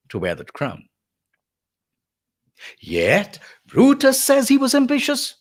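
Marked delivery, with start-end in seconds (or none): 2.8-5.3 high, sustained sarcastic declamation; rasping sarcasm. Acted. Lecturer as Antony repeating his refrain, mounting sarcasm. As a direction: pitch the charge up, then draw out the false praise. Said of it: "this sarcasm and the rasping delivery".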